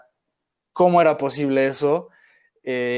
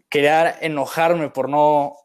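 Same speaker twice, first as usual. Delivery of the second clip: ordinary talk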